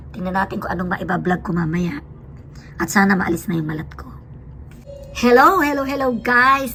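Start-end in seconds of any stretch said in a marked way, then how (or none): 5.1-6.7 bright, loud voice check, higher and louder; proud the hoarseness is gone; clear voice test. Real, not acted. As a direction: belt out a greeting to test the voice. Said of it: "Nawala na yung malat ko".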